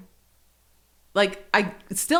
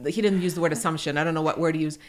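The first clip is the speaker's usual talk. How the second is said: normal talking voice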